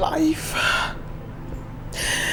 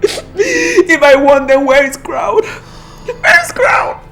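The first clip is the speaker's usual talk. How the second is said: slow, tearful plea, the voice breaking; desperate tearful asking where he is; crying; 10 dB louder, slower than usual. Acted. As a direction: sob the question out slowly. Grieving daughter argues with mother.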